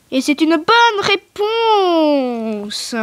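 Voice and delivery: drawn-out right-answer reveal; slow quiz verdict. Pretend. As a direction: savor the reveal like a TV host. Host confirms, slow reveal.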